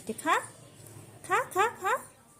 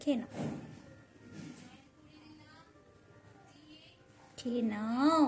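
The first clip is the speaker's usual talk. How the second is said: tender soft coaxing; 11 dB quieter than usual. Real, not acted.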